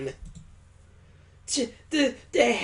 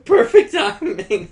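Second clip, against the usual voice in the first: loud, pitched-up delight; excited and much louder; gleeful; 4 semitones higher, 11 dB louder than usual. Acted. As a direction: greet an accomplice with glee. Gleefully greets a newcomer.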